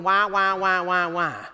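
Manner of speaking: muffled muted-trombone wah wah, lightly mimicked; Peanuts adult trombone voice. Teller mocking how he sounded to the bartender, light muted-trombone wahs. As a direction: imitate the garbled teacher from Peanuts, lightly. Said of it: "a Charlie the Peanuts adult going".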